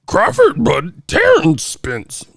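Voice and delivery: high, drawn-out mock creature voice; Yoda. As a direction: read it slowly like a baffled alien sage. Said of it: "Dyslexic Yoda sounded that".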